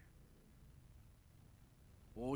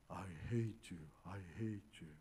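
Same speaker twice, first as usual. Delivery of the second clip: echo; 6 semitones lower, much slower than usual. Retold or imitated. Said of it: "소리가 들려오는데 여기저기서".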